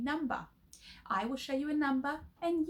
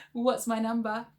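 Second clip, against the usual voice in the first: louder silly voice with a laugh; funny voice; 8 dB louder than usual. Shown as a demonstration. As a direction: ham it up in a funny voice. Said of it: "shout them out in your funny voices".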